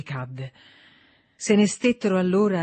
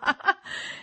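breathy mocking laugh; 14 semitones higher than usual. A narrator's reading. John taunting his mother.

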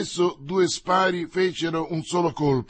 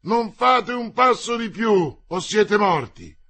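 loud, raised warning to halt; shouted threat; 6 semitones higher, 5 dB louder than usual. A narrator's reading. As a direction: threaten them at the top of your voice. Armed sentry threatens approaching riders.

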